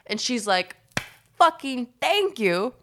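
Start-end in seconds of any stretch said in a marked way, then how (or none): 1.4-2.7 emphatic exasperation. Retold or imitated. Aunt's exasperated retort, retold.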